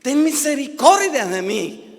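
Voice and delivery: loud, high cry for mercy; insistent shout. Acted. Blind man calling Jesus despite the crowd, shouting. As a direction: shout it over those hushing you. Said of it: "Él siguió gritando".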